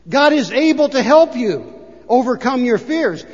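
ordinary delivery

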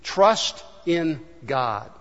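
very slow, each word weighed; slow answer to the question; slow emphatic. Shown as a demonstration. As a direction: drag it out firmly. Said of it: "What's the solution?".

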